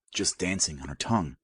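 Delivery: ordinary delivery, nothing marked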